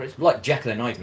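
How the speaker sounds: normal talking voice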